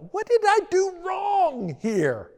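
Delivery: mock-indignant inner whine; defensive. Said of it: "another part of me almost was defensive".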